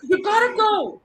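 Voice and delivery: high, loud mock yell; insistent urging. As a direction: shout it as an urgent, drawn-out push. Insisting after the refusal.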